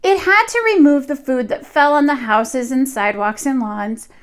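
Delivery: put-on lively read-aloud voice for young children; storybook reading. A narrator's reading. Teacher reading a picture book to her class, lively storytelling. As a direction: tell the tale to the class as if they sit on the rug.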